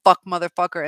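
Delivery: 1 mock angry retort. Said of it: "it's like".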